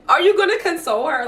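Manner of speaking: incredulous reaction. Retold or imitated. Acted indignant reaction to man watching.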